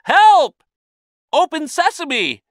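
high, loud cry for help; urgent high-pitched shout; panicked cry. A narrator's reading. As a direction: call out in alarm, trapped. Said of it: "he cried".

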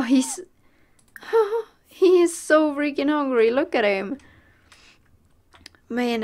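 ordinary talk, nothing marked

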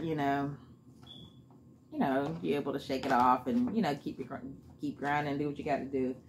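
chewing while talking; speaking between bites of salad; mouth full. Real, not acted. Seen 0: fork of salad to mouth repeatedly as she speaks.

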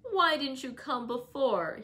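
high, slow whiny complaint; fretful invalid. A narrator's reading. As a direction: whimper like a spoilt sick boy. Linton reproaches Catherine.

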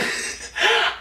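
very high airy laugh. Real, not acted. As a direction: squeal a breathless laugh. Laugh at memory of bullies.

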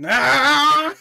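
short battle yell; shout. Acted. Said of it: "they do the shout".

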